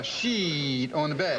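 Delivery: SHEET with a very long EE; mock Southern "sheeeet"; imitated Southern drawl. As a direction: stretch the vowel in SHEET like a Southerner. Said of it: "twelve E's and a T".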